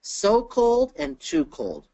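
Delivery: high, slow contrast of "so" and "too"; teacher setting "so" against "too"; stress on SO and TOO. Shown as a demonstration. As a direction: punch "so", then "too", drawing both out. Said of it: "there's a difference between".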